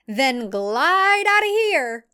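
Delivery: Audrey ordering the women out, screaming. Acted. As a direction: yell it at them, pointing out. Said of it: "Audrey screams".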